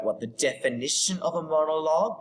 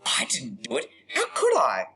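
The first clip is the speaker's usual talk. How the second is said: mock indignant denial. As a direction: protest innocence with a soaring question. Repeats suspect's denial while typing.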